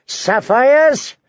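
high, drawn-out shout; high-pitched cry. A narrator's reading. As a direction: yell it out for all to hear. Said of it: "as loudly as he could".

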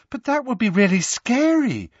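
lightly voiced girl. Lavender voiced by narrator.

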